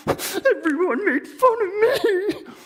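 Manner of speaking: very high, slow mock sobbing; crying Grover. Grover crying to his friends, high slow mock sob. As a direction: blubber it out. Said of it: "burst into tears".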